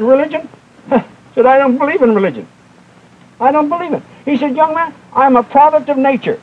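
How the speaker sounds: lightly mock the drunk's defiance; scornful drunk. Preacher voices the drunk man.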